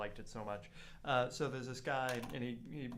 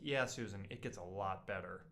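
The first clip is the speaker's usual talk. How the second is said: deadpan chicken; 5 semitones lower, 4 dB quieter than usual. Retold or imitated. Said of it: "And then the chicken says".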